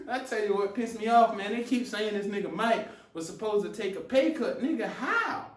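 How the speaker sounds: normal talking voice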